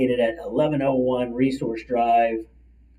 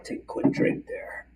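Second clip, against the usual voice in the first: quick unvoiced whisper to herself; whispered aside; 5 dB quieter than usual. Real, not acted. Host pausing her livestream for a drink, whispered aside. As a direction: mutter it off-mic while taking a sip.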